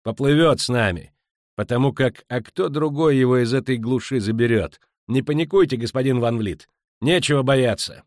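loud, high, forceful dismissal; emphatic, impatient insistence; brusque reassurance. Acted. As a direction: firmly overrule a panicking passenger. Captain overrules the panicking merchant.

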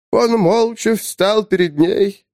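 high, slow tale-telling start; storyteller's opening. Acted. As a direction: begin the tale slowly, grandly. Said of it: "так начал Кляп". (торжественно, медленно, как сказитель)

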